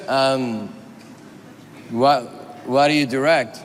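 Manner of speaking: low, hesitant, confused question; imitating a puzzled man groping for words; halting low mumble of confusion; Warren Beatty. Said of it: "Warren Beatty's all confused".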